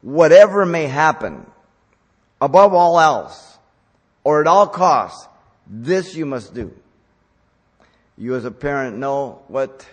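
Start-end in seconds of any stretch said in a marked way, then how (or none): 0.0-6.7 loud, rising urgent demand; urgent insistence. Acted. Preacher voicing Paul's demand, loud and insistent. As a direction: press it as a matter of life and death. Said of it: "As if to say".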